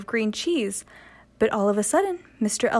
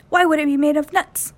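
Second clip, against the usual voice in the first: a touch higher, louder squirrel; a hint of a puzzled squirrel; lightly voiced squirrel; 5 semitones higher, 5 dB louder than usual. A narrator's reading. Mister Squirrel doubts Willie, lightly voiced and puzzled. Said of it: "Mister Squirrel".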